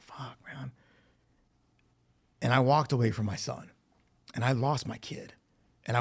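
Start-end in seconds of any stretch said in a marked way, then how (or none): 0.0-0.7 soft unvoiced whisper of dismay; whispered curse. Stunned by her story, a whispered curse. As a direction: breathe the curse out, barely voiced.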